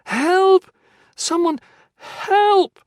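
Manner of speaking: high, loud desperate cry for help; panicked yell. Acted. Trapped in the cellar, calling for help: a panicked high yell. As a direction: scream it out into the dark cellar. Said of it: "she yelled".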